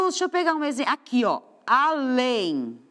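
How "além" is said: "além" stretched slowly over both syllables; teacher's slow, careful "além"; sounded-out word. Shown as a demonstration. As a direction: draw out "a-lém" for the class. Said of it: "a minha última letra".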